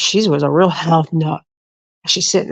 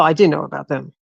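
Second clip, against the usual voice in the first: ordinary delivery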